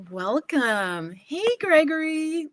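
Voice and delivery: big singsong welcome; delighted greeting. Real, not acted. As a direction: greet a newcomer as they pop in, stretched high and loud. Greeting viewers as they join live.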